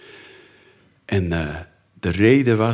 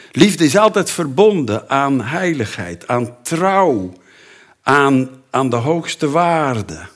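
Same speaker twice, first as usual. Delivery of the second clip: loud, raised preaching; preaching at full force; 5 semitones higher, 5 dB louder, slower than usual. Acted. Preacher proclaims love to the congregation, loud and raised.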